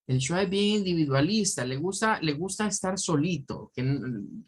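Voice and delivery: ordinary delivery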